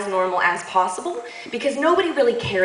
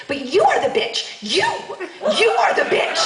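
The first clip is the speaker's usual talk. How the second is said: higher, emphatic retold outburst; pissed off; 10 semitones higher than usual. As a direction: throw the accusation back in acted outrage. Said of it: "now I'm pissed".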